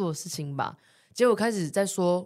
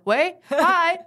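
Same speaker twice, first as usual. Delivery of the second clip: mock cheerful greeting; 11 semitones higher, 7 dB louder than usual. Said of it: "什么？".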